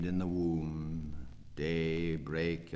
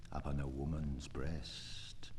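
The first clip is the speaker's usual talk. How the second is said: soft confiding whisper; 6 semitones lower, 9 dB quieter than usual. Acted. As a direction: let it slip out as a secret. Confessional poem line whispered.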